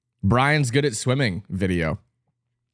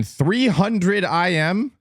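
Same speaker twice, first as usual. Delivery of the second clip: baffled; 8 semitones higher, much slower than usual. Real, not acted. Baffled at odd event.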